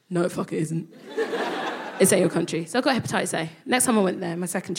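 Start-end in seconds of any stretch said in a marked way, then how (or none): 0.1-2.7 lower, laughing rebuke; mock country voice. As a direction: lower it, laugh through the rejection. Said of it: "And it went".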